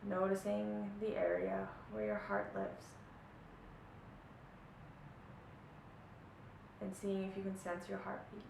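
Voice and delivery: soft, hushed meditation voice with long pauses; guided meditation. Acted. Teacher easing listeners into a body check-in, soft meditation voice. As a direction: guide them gently inward, leaving long silences between phrases.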